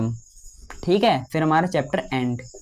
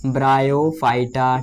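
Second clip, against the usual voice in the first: very slow, lowered dictation of a term; 4 semitones lower, much slower than usual. Real, not acted. Teacher spells out a plant group for students, slow dictation. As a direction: stretch it out for note-takers.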